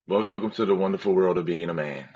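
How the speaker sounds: normal talking voice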